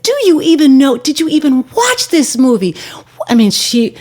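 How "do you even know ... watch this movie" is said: high, indignant imitation of her tirade; mock outrage, pitched well up; retold outburst. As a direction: act out her going off at them. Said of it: "she went off".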